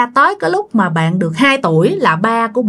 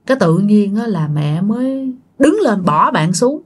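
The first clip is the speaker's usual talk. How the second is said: usual speaking voice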